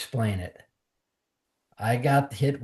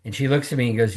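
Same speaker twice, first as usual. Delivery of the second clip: usual speaking voice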